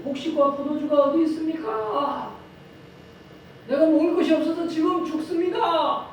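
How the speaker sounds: lightly voiced dying child begging mother for food; dying child pleading. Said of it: "죽어갈 때에 이렇게 말한다는 거예요".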